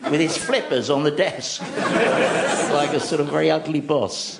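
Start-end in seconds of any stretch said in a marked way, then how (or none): 1.7-2.7 short breathy chuckle; amused chuckle at the sea lion on the chair; real laugh. Real, not acted. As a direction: chuckle at the picture. Laughing mid-story.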